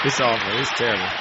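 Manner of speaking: low, soft aside; muted aside. Real, not acted. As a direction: drop the voice right down, well below preaching pitch. Low aside amid the harangue.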